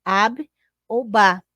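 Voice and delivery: Ab ou ba said slowly, syllable by syllable; teacher slowly modelling Ab ou ba; syllables sounded out slowly. Shown as a demonstration. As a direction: model each syllable for the class. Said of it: "podem ser montadas com B e com A".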